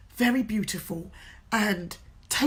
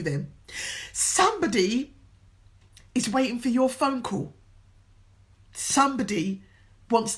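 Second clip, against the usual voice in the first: louder, rousing pep talk with long pauses; stand-up-and-inspire closing words; motivational speech; 4 dB louder than usual. Acted. Motivational address to viewers.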